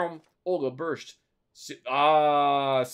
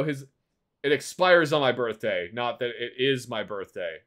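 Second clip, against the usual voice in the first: ordinary talk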